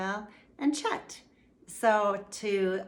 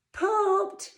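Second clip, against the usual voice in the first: very high, laughing yucky; giggling; 12 semitones higher, 5 dB louder, slower than usual. A narrator's reading. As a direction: giggle through the words. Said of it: "The owl giggled".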